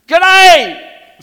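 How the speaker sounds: g'day shouted high and very loud. Shown as a demonstration. Pastor showing kids how to greet, big shout. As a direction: bellow g'day to rouse them. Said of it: "No, you gotta".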